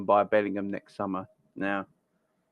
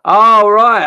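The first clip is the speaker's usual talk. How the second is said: sudden realisation; 9 semitones higher, 16 dB louder than usual. Real, not acted. Finally spots the missed comment.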